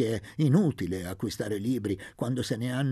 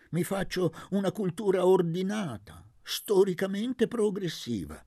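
higher, lively pontificating; eccentric old man; 7 semitones higher than usual. A narrator's reading. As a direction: lecture with proud zeal. Don Benedetto filosofeggia. (vecchio erudito entusiasta, voce alta)

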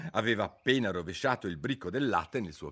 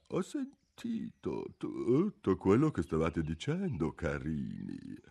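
sleepy dormouse; 4 semitones lower, slower than usual. A narrator's reading. Dormouse voiced in the story.

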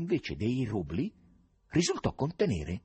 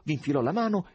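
ordinary delivery

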